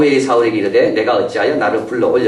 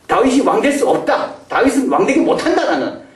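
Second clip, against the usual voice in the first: higher, laughing mock boast; boastful Saul; 9 semitones higher than usual. 다윗을 막겠다는 사울, high mocking boast. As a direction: puff up like a proud king. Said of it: "이렇게 생각한 거예요".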